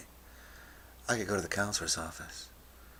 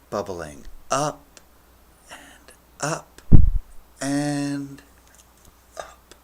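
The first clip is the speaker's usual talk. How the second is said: rising climb; 5 semitones higher, 12 dB louder, much slower than usual. A narrator's reading. Telling how the acid came on, slow climbing build. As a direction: voice climbing with each step, long pauses between.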